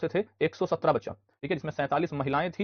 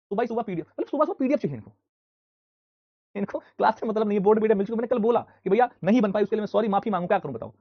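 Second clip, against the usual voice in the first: much higher and louder, fed-up scolding; exasperated rant; 6 semitones higher, 6 dB louder than usual. Real, not acted. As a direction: scold the class for demanding PDFs at dawn. Teacher ranting about PDF again.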